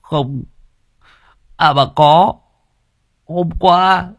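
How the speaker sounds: high, wavering, on the verge of tears; whimpering. A narrator's reading. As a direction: stammer it out through tears. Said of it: "Nghĩa mới mếu máo". (mếu máo sắp khóc)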